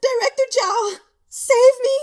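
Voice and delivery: high, loud wail for help; desperate scream. A narrator's reading. Guo Changcheng kneeling, begging the director to save him, screaming. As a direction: scream for rescue at the top of your lungs. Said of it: "he stretched out his neck and screamed".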